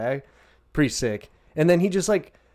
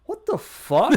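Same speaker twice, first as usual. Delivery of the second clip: much higher and louder, caught off guard; shocked outburst; 13 semitones higher, 6 dB louder, slower than usual. Retold or imitated. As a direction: blurt it out in startled disbelief. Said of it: "and he's like".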